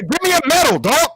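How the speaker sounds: high cocky shout; loud. Real, not acted. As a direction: demand praise, yelling. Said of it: "You don't have to be so loud".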